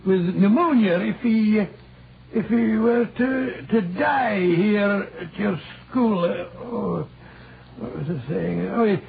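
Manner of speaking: a light put-on Scottish accent, rolling the r's. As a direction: play a canny Scot from Aberdeen, lightly. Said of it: "that delightful Scottish accent you sometimes assume".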